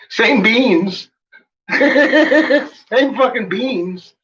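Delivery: high, laughing disbelief. Real, not acted. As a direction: laugh through the words. Laughing about same beans.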